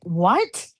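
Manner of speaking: retold taken-aback "what", rising at the end; mildly offended, questioning recoil; upset disbelief. Friend retells reacting to her request, upset disbelief. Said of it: "I was getting so upset".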